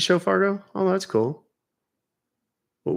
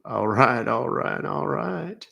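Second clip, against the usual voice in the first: Texas drawl; slower than usual. Pretend. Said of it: "How about Texas?".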